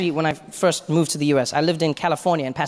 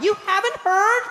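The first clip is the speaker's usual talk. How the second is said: very high-pitched exaggerated shock; mock disbelief. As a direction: squeal the question in hammed-up amazement. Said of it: "they can't believe you haven't had".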